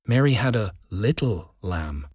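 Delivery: "little" sounded out, set apart by small pauses; emphasize the word "little"; stress on little. Shown as a demonstration. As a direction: hold back, then lean on "little" before finishing. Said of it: "emphasizing different words".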